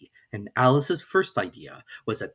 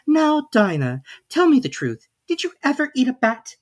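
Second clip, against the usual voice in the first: put-on higher, earnest girl's voice; earnest Alice; 13 semitones higher, 6 dB louder than usual. A narrator's reading. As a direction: ask the cat very seriously. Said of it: "saying to her very earnestly".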